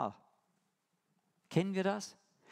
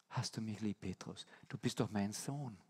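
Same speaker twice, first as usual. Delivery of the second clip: softer, lower, gentle fatherly question; quiet tender plea, lower; 6 semitones lower, 7 dB quieter than usual. Retold or imitated. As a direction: speak to Peter as a beloved son. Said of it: "spricht ihn an mit dem, was er ist".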